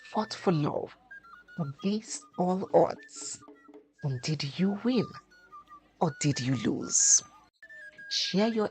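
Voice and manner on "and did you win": plain reading voice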